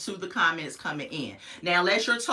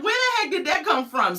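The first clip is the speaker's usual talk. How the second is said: startled; 9 semitones higher, 3 dB louder than usual. Retold or imitated. Quoting her reaction to the voice.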